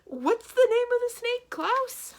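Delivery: very high, loud panicked asking; a flustered banker asking the snake's name; frantic high-pitched question. A narrator's reading. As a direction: squeak it in a flurry. Poe panicking, asks Klaus.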